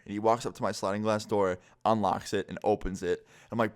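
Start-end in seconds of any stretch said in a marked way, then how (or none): none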